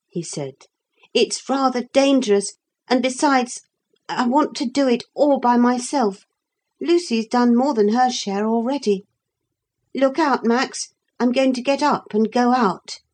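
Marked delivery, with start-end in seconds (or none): 1.1-13.0 put-on high, resolute child character; a boy set on going it alone; determined boy. Philip, boy figure, sets out plan.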